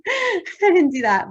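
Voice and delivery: giggling through the words; high, loud laughing reply. Real, not acted. Laughing about whistling test.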